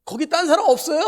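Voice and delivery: hanging man shouting for another helper, comically; comic shout. Retold or imitated. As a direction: yell out for anyone else, joking. Cliff man asks for someone else.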